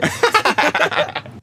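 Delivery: loud, high burst of laughter. Real, not acted. Vlogger greeting a new friend, loud high laugh.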